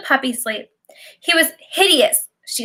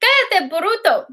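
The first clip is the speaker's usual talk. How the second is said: slow Spanish scold, mildly raised; a hint of the old neighbor yelling at the dog; mock scolding yell; 6 semitones higher than usual. Abuela yelling down at the barking Rottweiler, mock scold. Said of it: "abuela used to scream down at him".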